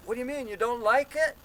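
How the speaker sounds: loud, high-pitched protest; acted-out indignant surprise; retold shock. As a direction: question the heckler in stunned disbelief. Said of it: "I'm so in shock".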